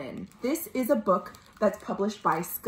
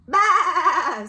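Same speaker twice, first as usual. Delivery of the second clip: high, wavering baa; quavering storybook sheep noise; sheep bleat; 7 semitones higher, 4 dB louder than usual. Acted. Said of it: "said Sheep".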